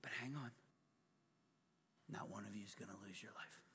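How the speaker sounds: fast unvoiced whisper. Real, not acted. Preacher reassuring the congregation in a whisper. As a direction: lean in and promise softly.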